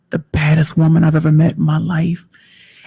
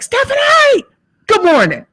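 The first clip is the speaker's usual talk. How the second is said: very high, sing-out wake-up call; hammed-up, squealing good-morning greeting; exuberant morning greeting. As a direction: call out names in an over-the-top high-pitched hello. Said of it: "I'll text her, I'll be like".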